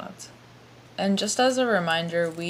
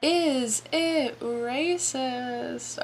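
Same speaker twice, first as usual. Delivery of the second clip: drawn-out, higher game show title call; game show announcer; 6 semitones higher, much slower than usual. Pretend. Host announces a joke segment title, drawn-out announcer voice. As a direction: stretch every word like a mock show host.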